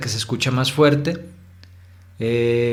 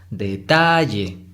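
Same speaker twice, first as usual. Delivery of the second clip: detalle slow, TA stretched and stressed; stress on middle syllable; much slower than usual. Shown as a demonstration. Teacher confirming the correct tonic syllable, middle TA stressed in detalle. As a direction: draw out the ta in detalle. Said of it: "la de en medio".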